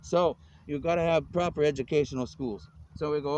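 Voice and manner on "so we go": ordinary talk, nothing marked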